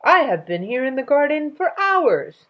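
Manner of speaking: high, loud amused king. Acted. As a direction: tease them grandly.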